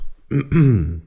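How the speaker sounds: clearing throat to reset voice; short cough to clear the throat. Real, not acted. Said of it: "I'm just gonna do a little cough".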